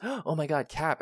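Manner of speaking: gasp, then high-pitched awe; high, breath-caught mock amazement; starstruck Deadpool. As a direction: imitate an overjoyed fan recognizing a hero. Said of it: "and he goes".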